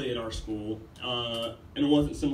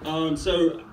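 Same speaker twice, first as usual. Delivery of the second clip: normal talking voice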